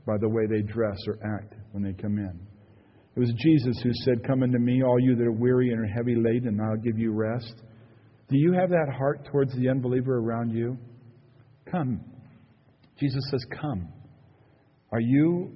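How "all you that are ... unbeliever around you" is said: usual speaking voice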